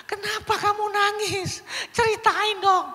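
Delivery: put-on high, loud interrogation of a child; demanding questioning. Shown as a demonstration. Teacher grilling a crying child the wrong way, demanding. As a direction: press for an answer, loud and high-pitched. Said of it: "Tugas kita itu bukan lagi begini".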